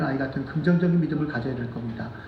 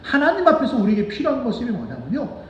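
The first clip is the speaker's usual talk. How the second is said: ordinary talk, nothing marked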